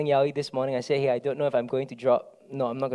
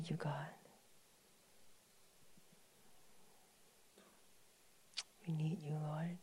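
breathy whisper, almost no voice; whispered prayer; 8 dB quieter than usual. Real, not acted. Pastor's intimate prayer to God, whispered.

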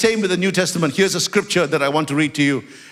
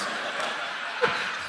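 soft laugh; 11 dB quieter than usual. Real, not acted. Laughing at himself.

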